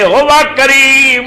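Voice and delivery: very slow, high and loud joyful proclamation; full of emotion. A narrator's reading. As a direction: cry out each praise, overwhelmed. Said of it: "جذبات نال کھڑے ہو گئے". (جذبات نال اُچی تے ہولی ہولی پکار)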